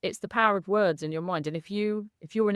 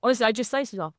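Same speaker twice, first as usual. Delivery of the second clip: ordinary delivery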